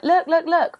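high, loud eager "look, look, look"; hammed-up self-promo, calling for attention; mock excited plugging. Plugging her own podcast on Twitter, mock excited. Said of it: "I'm going to town on being like".

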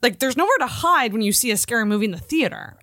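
usual speaking voice

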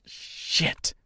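whispered curse to herself; under-breath whisper. Acted. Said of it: "she said to herself".

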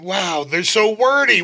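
ordinary delivery

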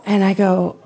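ordinary delivery

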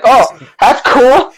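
slow words through laughter; laughing. Real, not acted. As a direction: laugh while answering awkwardly. Awkward laughing reply to stranger.

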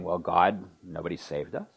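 mild, low grumble trailing off softly; complaining. Acted. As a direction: voice a people's complaint, subdued and falling away. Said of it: "They're complaining and saying".

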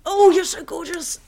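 put-on high, loud baby cooing. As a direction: gush over the baby. Cooing over newborn.

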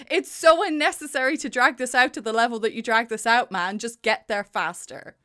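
normal talking voice

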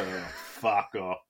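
low, slow muttered brush-off; lightly voiced grumpy Brian Cox; mock dismissive. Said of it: "Maybe the extras are".

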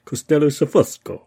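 loud final try at Costello Sofosco; another attempt. Shown as a demonstration. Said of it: "I can't say it very well".

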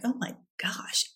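breathy whispered realization. Retold or imitated. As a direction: whisper it in shock. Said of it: "I'm like".

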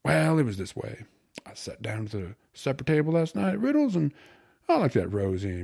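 put-on loud, animated slangy young man; gleeful slangy storyteller. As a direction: spin the tale proudly, building to the punchline. Voices Chunk telling his tale.

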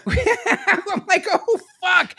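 high laughing cry of dismay. Real, not acted. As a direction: say it through a high, helpless laugh. Laughs while reliving his freak-out.

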